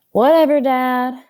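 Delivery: loud, eye-rolling brush-off; a bored daughter waving off her father; bored teenager. A narrator's reading. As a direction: dismiss him like a sulky teen. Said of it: "sounding like a bored teenager".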